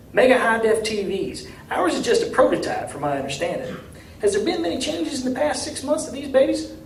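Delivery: a down-home mayor, friendly and a bit anxious; small-town mayor. A narrator's reading. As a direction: play the hopeful country mayor asking for help. Mayor voiced in story.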